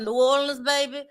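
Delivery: tentative New Orleans accent attempt; unsure stab at New Orleans speech. Shown as a demonstration. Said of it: "and I can't do it".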